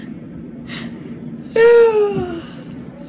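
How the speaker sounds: drawn-out tired sigh; long weary sigh. Real, not acted. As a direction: sigh out the exhaustion. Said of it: "I'm tired now".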